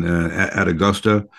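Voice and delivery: normal talking voice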